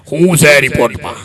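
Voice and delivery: low, gruff grandpa voice; old man asking the doctor about his report. Retold or imitated. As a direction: drop low as the old patient. Old man asks result.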